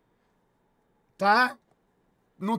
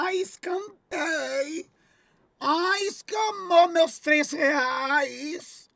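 slow, stretched, higher mock whine; mocking whiny donor; 6 semitones higher, much slower than usual. Streamer mimics a viewer whining about three reais, slow mock whine. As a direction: drag it out like a crybaby. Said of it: "se você falar".

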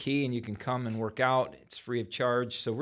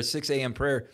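usual speaking voice

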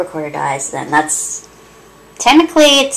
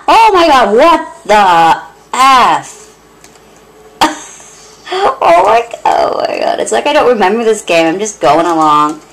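normal talking voice

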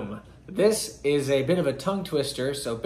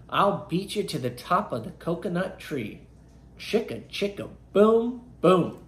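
put-on reading to children; 5 semitones higher than usual. Reads picture book aloud.

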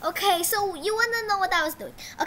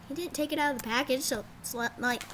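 ordinary talk, nothing marked

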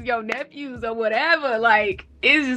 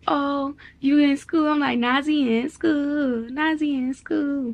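higher, drawn-out singsong teasing; playful teasing; 4 semitones higher than usual. Retold or imitated. Mom picking with her son about starting school, teasing. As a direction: tease him in a slow, raised singsong. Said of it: "I'm, like, picking with him".

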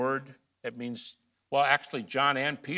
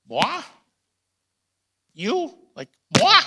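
mock incredulous; 13 semitones higher, 10 dB louder than usual. Mock disbelief at loving neighbor.

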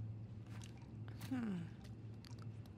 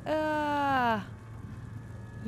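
ordinary talk, nothing marked

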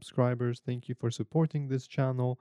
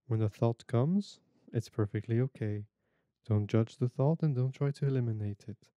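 guided meditation. Acted.

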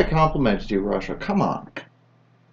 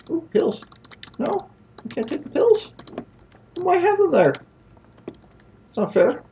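put-on much higher whiny little-kid voice; whiny child. Player denied the pills, whining in a much higher childish voice. Pouting that he can't take the pills.

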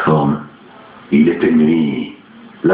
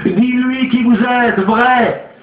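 high, pressing exhortation; urgent medium; 13 semitones higher, slower than usual. A narrator's reading. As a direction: urge them on desperately. Olcott urges brothers and spirit.

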